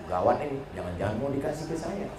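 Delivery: hushed comic aside, dropping low; worried aside. Acted. Preacher privately fears being offered the daughter, muttered aside. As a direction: mutter it quickly to yourself.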